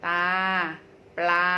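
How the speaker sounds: ตา and ปลา with long held aa; drawn-out syllables. Shown as a demonstration. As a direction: stretch each vowel in ตา, ปลา. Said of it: "เสียงจะลากยาวมากขึ้น".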